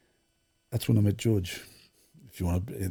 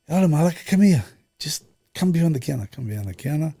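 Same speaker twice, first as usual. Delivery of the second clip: a retold shopkeeper's call, higher and louder at first, then settling; George calling out; 6 semitones higher, 6 dB louder than usual. George hails his friend in the packed chip shop, calling out loud. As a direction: call a friend over from behind a busy counter. Busy George calls him behind the counter.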